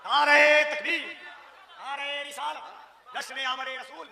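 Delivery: high chanted calls to the crowd; preacher calling for the crowd's response, chanting high; call-and-response slogans. Real, not acted.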